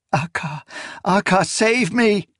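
desperate plea. A narrator's reading. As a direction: cry out for rescue. Terrified plea for rescue.

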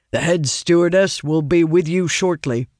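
flat, even guard voice; monotone guard. A narrator's reading. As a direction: say it like a bored guard. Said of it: "in a monotone voice".

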